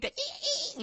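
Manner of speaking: very high squeaky ee, ee; high imitation of a squeaking toy; squeaky toy noise. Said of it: "doggy toys that squeak".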